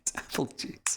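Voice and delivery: soft, slow whisper; hushed joke aside; whispered confession. Real, not acted. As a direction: whisper it like a secret. Joking confession about drink.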